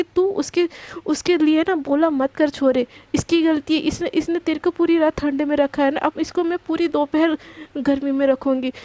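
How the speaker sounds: ordinary delivery, nothing marked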